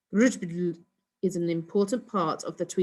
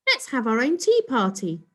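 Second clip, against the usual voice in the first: put-on girl voice; 5 semitones higher, 5 dB louder than usual. A narrator's reading. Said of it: "Sue suggested".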